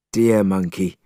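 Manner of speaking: ordinary delivery, nothing marked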